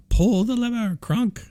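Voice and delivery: high, shrill villain yell; Yzma voice. Retold or imitated. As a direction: screech it like Yzma. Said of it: "Yzma yells out".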